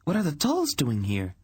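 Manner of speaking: put-on high, alarmed girlish voice; young princess. Princess questions Dernik about enemy prisoners, alarmed.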